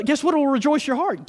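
ordinary delivery

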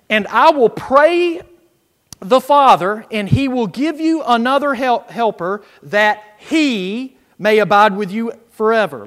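loud, slow pulpit reading of John; preached Scripture reading. Acted. Preacher reading Jesus' words aloud to the church, loud and slow. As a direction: proclaim the verse to the congregation.

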